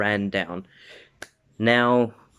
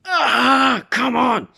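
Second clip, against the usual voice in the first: high, loud acted battle cry; straining yell; 6 dB louder, slower than usual. Bakugo being dug out of the sludge, straining yell. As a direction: yell through a huge effort.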